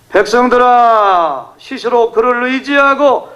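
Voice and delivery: preacher crying out the verse; impassioned scripture quote. Acted. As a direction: call it out to the people, rising. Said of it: "이렇게 했어요".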